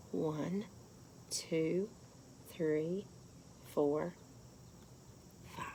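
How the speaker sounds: soft whispered count. Shown as a demonstration. Teacher softly leads kids counting their spots. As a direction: count the spots gently, barely voiced. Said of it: "soft counting demo".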